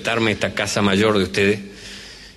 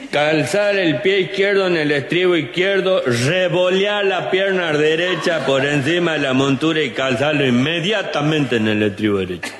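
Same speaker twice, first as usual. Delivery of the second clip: reading the manual; 6 semitones higher than usual. Acted. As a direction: spell out each step like a schoolboy reading. [name] reading riding manual aloud.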